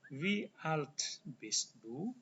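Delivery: drawn out, every syllable clear; slow demo rising on "Wie"; slow model question. Shown as a demonstration. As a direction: model "Wie alt bist du?" at half speed. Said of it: "Cara tanya usia pakai".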